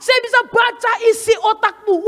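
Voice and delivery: high, emphatic mock insistence; a retold girl declaring she knows your thoughts; lightly hammed-up stubborn claim; defiant dancer. Dancer claims to read minds.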